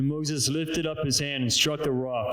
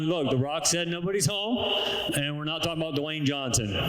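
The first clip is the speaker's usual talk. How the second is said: ordinary delivery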